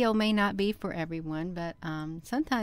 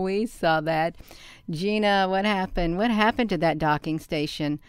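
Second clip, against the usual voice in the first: usual speaking voice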